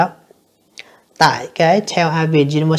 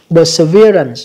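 Perseverance, third syllable punched louder; stress on third syllable; 6 dB louder, slower than usual. Shown as a demonstration. Teacher showing students the stress, third-syllable stress on Perseverance. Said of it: "nhấn âm thứ ba".

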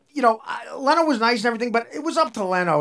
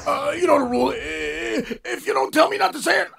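mocking John; 9 semitones higher than usual. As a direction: squeal out his lame excuse. Imitating John's excuse.

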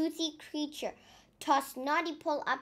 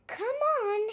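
higher, pleading pirate voice; a tired crewman urging on; high whiny crewman; weary pirate; 10 semitones higher, 3 dB louder than usual. Acted. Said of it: "sighed Pirate Nong".

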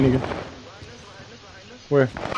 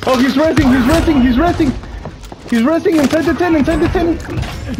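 excited yelling; 10 semitones higher than usual. Real, not acted. Enemy down in tent.

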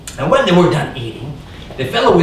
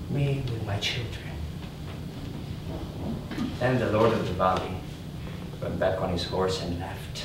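much softer, broken-hearted telling, fading to a whisper; grieving hush; 6 semitones lower, 11 dB quieter than usual. A narrator's reading. The peasant recalls watching helplessly: hushed grief. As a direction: let the grief sink the voice almost to nothing.

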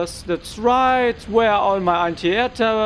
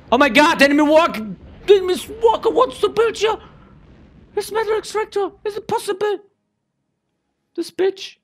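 shocked disbelief; 5 dB louder, faster than usual. Real, not acted. Shocked by enemy building nearby.

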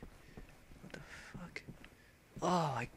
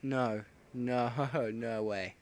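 ordinary talk, nothing marked

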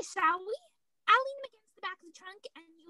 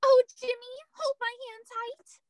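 pretend scared; 5 semitones higher, 5 dB louder than usual. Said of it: "let's pretend we're really afraid of him".

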